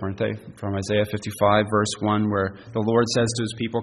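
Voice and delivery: usual speaking voice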